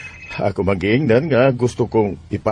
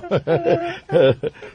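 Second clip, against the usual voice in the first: hearty laugh. Acted. Father greets children, laughs.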